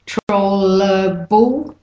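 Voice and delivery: controllable slowly broken up, troll-uh-bull; syllables separated. Shown as a demonstration. Teacher breaking the word down, controllable slow and split. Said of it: "er, or".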